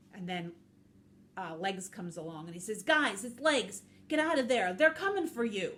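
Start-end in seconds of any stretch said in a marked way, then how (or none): 2.8-5.7 loud, raised storybook warning; acted urgent alert, projected. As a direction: call out to friends to get away. Character warns friends to escape.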